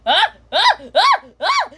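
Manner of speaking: very high, loud yelps; repeated mock screams. Child's character loses balance after showing how, high screams. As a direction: shriek in short repeated bursts.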